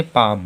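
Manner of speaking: पाम said "paam" with a low, silent L; model pronunciation. Shown as a demonstration. Teacher answers her own question with पाम. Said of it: "क्या पढ़ेंगे?".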